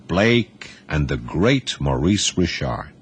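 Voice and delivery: plain reading voice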